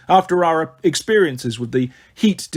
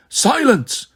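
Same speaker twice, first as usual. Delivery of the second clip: sharp, high-pitched command; ordering; 7 semitones higher than usual. A narrator's reading. King interrupting his servant, sharp order. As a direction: cut the servant off. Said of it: "Tarquin ordered".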